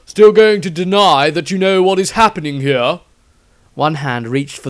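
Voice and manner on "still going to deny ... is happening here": higher, louder accusing priest; Tristram confronting. Acted. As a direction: demand the truth, pitched up and loud. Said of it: "Tristram turned to the man".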